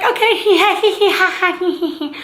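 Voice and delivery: high tittering hee hee laugh; mock giggling nurse. Nurse laughing at the patient's rant, high giggles. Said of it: "she's like".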